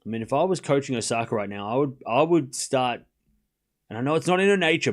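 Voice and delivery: usual speaking voice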